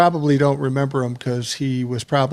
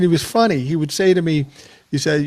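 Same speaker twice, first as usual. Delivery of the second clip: usual speaking voice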